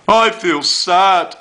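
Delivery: high, slow, hammed-up wail. Shown as a demonstration. Teacher showing Year Three how Bear wails, hammed-up wail. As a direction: wail the line like a crying toy bear. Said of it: "wailed Bear".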